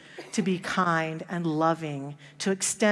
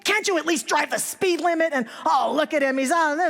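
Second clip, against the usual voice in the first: retold yell; 8 semitones higher than usual. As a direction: shout him down from behind the wheel. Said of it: "the guy in the red car is yelling at him".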